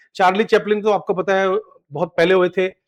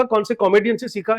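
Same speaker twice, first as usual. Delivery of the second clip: ordinary delivery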